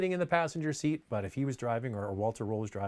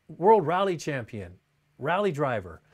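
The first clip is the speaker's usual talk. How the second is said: ordinary talk, nothing marked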